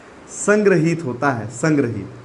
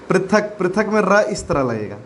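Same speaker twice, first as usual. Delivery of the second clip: normal talking voice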